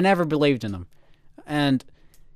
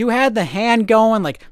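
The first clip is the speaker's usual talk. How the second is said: normal talking voice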